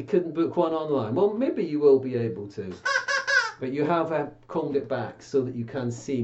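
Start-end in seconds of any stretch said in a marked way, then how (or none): 2.8-3.5 very high squeaky puppet cackle; a bird puppet's shrill chuckle; high cartoon bird laugh; puppet squawk laugh.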